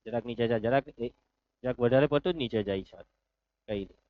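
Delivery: low, very soft murmured aside; hushed aside. Real, not acted. Teacher asking for a slight move down, hushed aside. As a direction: drop pitch and volume right down, as if off the record.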